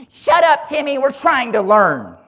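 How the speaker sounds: put-on loud, high shout at a kid; class yelling. Whole class turning on Timmy, loud high shout. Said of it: "the whole class of kids turns around and says".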